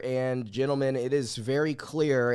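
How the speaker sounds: ordinary talk, nothing marked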